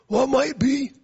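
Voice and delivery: loud, high, imitated old preacher; hammed-up preacher sound; blown-out preacher voice. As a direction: mimic a preacher who has shouted his voice out. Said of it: "You even sound like a preacher".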